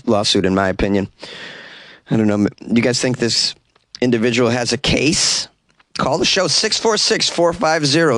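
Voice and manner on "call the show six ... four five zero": announcer call-in plug. Acted.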